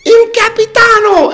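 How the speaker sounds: high, loud, slow mock Italian accent. Woman recognising the captain's picture, hammed-up Italian accent. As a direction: cry it out in an imitated Italian accent. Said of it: "I heard the Italian accent".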